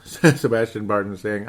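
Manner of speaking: ordinary talk, nothing marked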